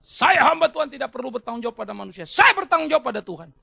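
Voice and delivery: loud, high declaiming pastor; mock a preacher loudly dodging blame; evasive pastor. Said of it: "kemudian pendeta berkelit".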